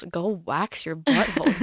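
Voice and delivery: slow, put-on bossy order; lightly voiced disgusted order; mock command. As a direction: tell them off in a funny voice. Mock order about boys.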